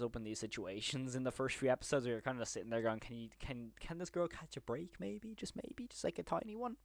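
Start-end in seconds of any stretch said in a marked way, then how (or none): 3.0-6.8 put-on high, airy plea for the girl; a whispered high plea, much higher; mock pleading. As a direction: beg the show, squeaky and hushed. Pleading on the character's behalf.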